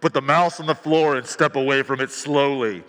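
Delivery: mother switches to a stern cop voice with her son. Retold or imitated. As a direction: order it like a cop talking down a suspect. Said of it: "does that cop voice".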